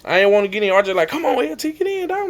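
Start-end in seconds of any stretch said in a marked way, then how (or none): 1.1-2.3 high, soft imitated coaxing; mock a teammate's high-pitched plea; urging teammate. Acts out being urged in.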